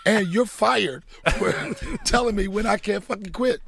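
laughing through the words. Real, not acted. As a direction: crack up while firing him. Laughing while retelling firing producer.

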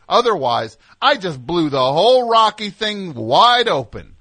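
loud, proud reveal; triumphant. Real, not acted. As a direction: announce the big discovery. Triumphant reveal of plot hole.

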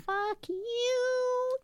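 very high, long-held note; teasing falsetto-like drawn-out call; playful high jeer. Pretend. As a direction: sing it out way up high. Playful jab after headline is read.

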